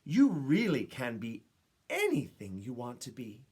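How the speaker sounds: ordinary delivery, nothing marked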